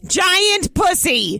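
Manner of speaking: big drawn-out wrestling shout; high, loud and slow, hyping a wrestler; announcer shout. Pretend.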